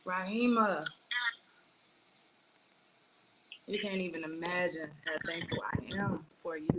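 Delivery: soft, low, tearful gratitude. Real, not acted. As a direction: give heartfelt thanks through held-back tears. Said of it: "balling my face up in tears".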